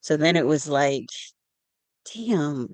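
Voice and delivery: normal talking voice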